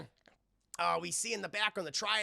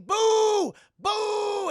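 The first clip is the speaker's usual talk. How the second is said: loud, much higher mock boos; booing; 14 semitones higher, 13 dB louder than usual. Karaoke crowd booing a bad singer, loud high boos. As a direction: jeer at the singer. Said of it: "Just saying".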